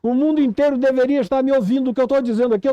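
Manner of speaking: impassioned preacher's cry, pitched high; urgent, high, strained-for-attention sermon. Real, not acted. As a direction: cry out like a prophet to the deaf. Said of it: "como eu tô gritando aqui pra surdos".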